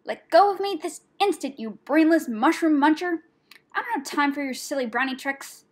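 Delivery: high, loud, furious scolding; angry hiss. A narrator's reading. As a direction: scold your captor indignantly. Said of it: "she hissed".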